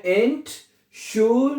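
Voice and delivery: "एंशुलडीगन" slowly, split as एन, शुल, डी, गन; teacher breaking "एंशुलडीगन" into separate syllables; broken into parts. Shown as a demonstration. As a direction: pause between the syllables of "एंशुलडीगन", drawing each out. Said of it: "उसको तोड़ लें".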